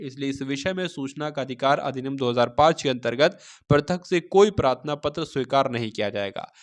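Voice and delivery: ordinary talk, nothing marked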